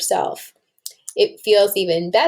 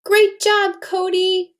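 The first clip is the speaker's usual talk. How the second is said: put-on much higher, bright cheer of a young girl; cheery little girl; 10 semitones higher, slower than usual. Lily praising Cody after his solo, high cheerful kid voice. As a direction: cheer him on like an excited kid.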